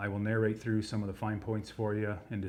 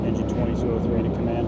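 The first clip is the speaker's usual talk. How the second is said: loud muffled radio call through a mask; firefighter shouting through mask; 7 dB louder than usual. Real, not acted.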